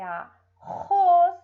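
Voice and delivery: "arroz" loudly, with a long throaty R; slow "a... RROZ", rasping the R; guttural R in "arroz"; strong R. Shown as a demonstration. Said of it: "É forte".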